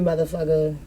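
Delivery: ordinary delivery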